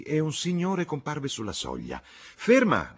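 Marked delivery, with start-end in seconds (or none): 2.0-2.9 high, loud cry of "stop"; woman's commanding yell, pitched high; shouted command. A narrator's reading. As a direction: call out sharply to halt the driver. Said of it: "gridò la signora Honeychurch".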